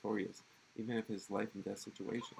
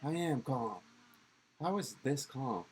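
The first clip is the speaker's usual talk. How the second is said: higher, louder, slow shouted protest; yelling i am calm; 4 semitones higher, 4 dB louder, slower than usual. Acted. Hothead yelling back at classmate who tells him to calm down. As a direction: insist on calm while yelling. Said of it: "just calm down".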